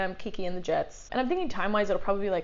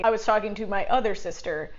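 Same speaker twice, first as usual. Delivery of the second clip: normal talking voice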